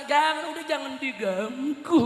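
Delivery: usual speaking voice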